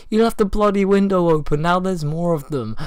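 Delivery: high, loud accusing mock yell; retold yelling. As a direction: imitate a brother yelling at his sister. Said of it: "he was, like, yelling at his sister".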